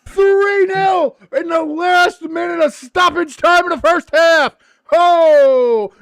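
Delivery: high shouting goal call, slow and stretched; goal commentator. Pretend. Calling the US goal to a friend, commentator shout.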